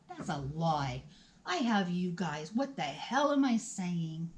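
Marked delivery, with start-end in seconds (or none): none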